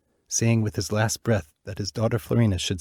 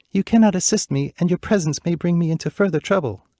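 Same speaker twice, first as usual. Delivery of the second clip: higher, louder anxious plea; urgent pleading; 9 semitones higher, 5 dB louder than usual. Acted. Princess begging the bluebird to leave, anxious and loud. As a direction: beg him to go before harm comes. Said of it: "cried Florina".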